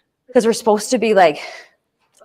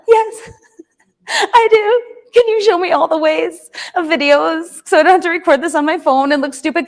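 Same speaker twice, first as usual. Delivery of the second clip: teary relief; 4 semitones higher than usual. Retold or imitated. Tearful relief at the help offered.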